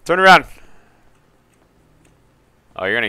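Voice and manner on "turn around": high, loud order; shouted command. Acted. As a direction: snap the order out. Commanding suspect.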